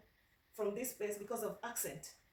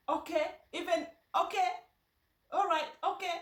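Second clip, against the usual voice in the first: higher, louder string of okays; hammed-up okay okay all right; eager fake agreement; 7 semitones higher, 5 dB louder than usual. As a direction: nod along pretending to understand. Imitating her own nervous agreeing.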